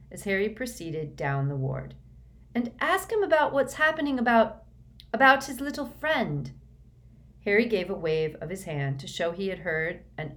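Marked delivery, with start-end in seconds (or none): 2.5-6.5 high, loud call after him. Acted. Hermione calls to Harry leaving the ward, loud and high. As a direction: call out across the ward, higher and louder.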